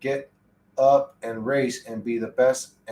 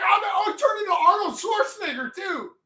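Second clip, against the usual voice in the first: high-pitched shout. Pretend. Streamer voicing a character switching to Schwarzenegger, high shout. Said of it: "He's like".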